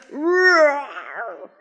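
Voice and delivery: long, high pretend roar; playful monster roar. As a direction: roar along for the children. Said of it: "Can we all roar".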